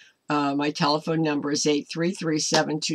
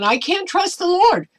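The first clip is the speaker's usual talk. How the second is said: much higher, louder whiny voice; mock doubter's whine; 11 semitones higher, 5 dB louder than usual. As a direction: mimic a faithless person, pitched way up. Voicing doubters' words.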